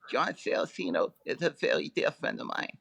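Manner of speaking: a light, emotional imitation of the boxer, close to his own voice; Mike Tyson impression. Guest quoting Tyson's tearful bear-hug plea, a light emotional imitation. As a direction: play a choked-up champ, only lightly voiced. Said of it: "your Tyson impression's right on".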